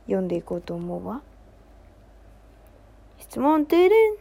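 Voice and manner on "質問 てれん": high, loud sung jingle cue. Acted. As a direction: announce the segment like a chime. Jingle before reading a question.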